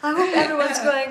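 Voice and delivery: loud, high, laughing cheer; rally the listeners with laughing glee; laughing excitement. Real, not acted. Presenter cheers her own Shetland singing, loud and laughing.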